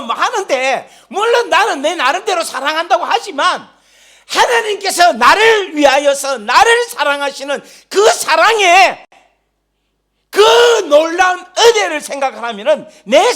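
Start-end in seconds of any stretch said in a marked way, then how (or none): none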